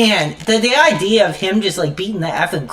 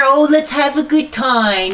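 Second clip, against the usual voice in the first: sarcastic sing-song, pitched higher; mocking cheerful; 5 semitones higher than usual. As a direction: mimic a fake happy streamer. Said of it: "I'm not here".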